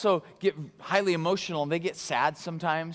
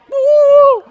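very high, loud woo; excited whoop; 20 dB louder than usual. Retold or imitated. Passionate person squatting while chatting, excited whoop. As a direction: let out a wild whoop. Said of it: "They're like".

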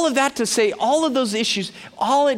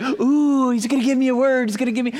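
higher-pitched starstruck fan, lightly hammed-up; mock gushing excitement; 4 semitones higher than usual. Conference crowd hoping for a word, mock gushing. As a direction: imitate an eager audience member.

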